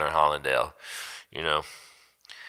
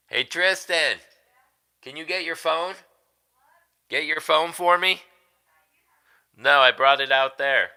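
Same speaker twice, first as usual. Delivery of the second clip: calling out; 11 semitones higher than usual. Real, not acted. Calling to son in another room.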